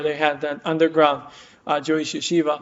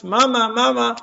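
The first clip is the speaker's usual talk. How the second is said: higher-pitched retold call for mama; child calling out; 7 semitones higher, 3 dB louder than usual. Child spots mother at the train station, calling out. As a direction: call out as the child, without a full shout. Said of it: "she hears a voice".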